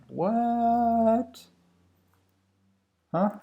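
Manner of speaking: long, drawn-out high-pitched "what"; baffled surprise. Real, not acted. Coder to self at unexpected error, baffled surprise. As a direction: stretch it out high in disbelief.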